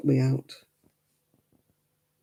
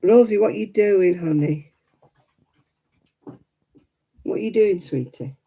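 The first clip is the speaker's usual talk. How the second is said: sing-song coaxing to the dog; talking to a pet; 3 semitones higher than usual. Real, not acted. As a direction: coo gently at the dog.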